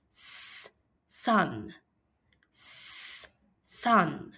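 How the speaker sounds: isolated S hiss, a pause, then "sun"; slow sounding-out. Shown as a demonstration. Teacher showing the first sound of sun, S apart then the word. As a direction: hold S alone before saying "sun". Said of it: "When I say the word sun slowly".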